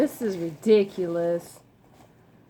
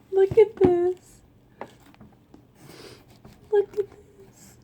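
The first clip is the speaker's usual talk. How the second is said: high, tearful gratitude; real emotional, grateful near-crying; overcome with emotion; 7 semitones higher than usual. Real, not acted. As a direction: squeak it out through happy tears. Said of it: "I'm so emotional".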